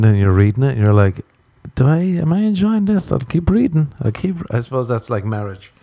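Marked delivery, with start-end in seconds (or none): 1.7-4.4 higher, hesitant self-questioning; second-guessing whether you like it; doubtful inner voice. Retold or imitated. Reader doubting the book, hesitant and higher.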